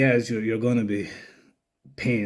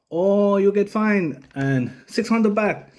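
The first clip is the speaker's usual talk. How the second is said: higher, slow, imitated Thai-English verdict; imitating the cop; 8 semitones higher, slower than usual. Cop telling the biker his fine, slow mock Thai accent. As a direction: spell out the fine like the officer did.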